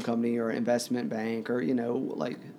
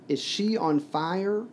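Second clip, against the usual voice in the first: higher, drawn-out question rising at the end; raised voice; 6 semitones higher, slower than usual. A narrator's reading. Child asks if his mother burns, in a mildly raised voice. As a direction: stretch it slowly upward, urgent. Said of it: "he said in a loud voice".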